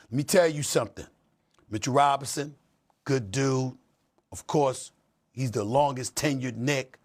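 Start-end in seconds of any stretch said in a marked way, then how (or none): none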